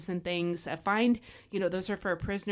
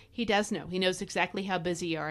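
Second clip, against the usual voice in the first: ordinary talk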